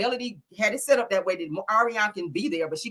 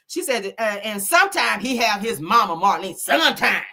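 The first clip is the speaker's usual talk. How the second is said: ordinary delivery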